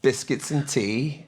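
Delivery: slow, mock British accent; American doing a slow British accent; British accent attempt. Shown as a demonstration. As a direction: draw it out in your best British accent. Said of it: "British accent. Let me hear something".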